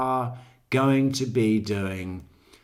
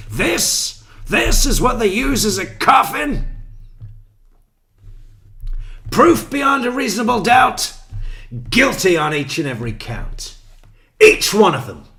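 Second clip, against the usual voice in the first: loud, high mock courtroom thunder; hammed-up prosecutor shouting the verdict; imitating angry prosecutor; 10 semitones higher, 8 dB louder than usual. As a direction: boom it out like a furious prosecutor. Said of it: "she's holding it up going".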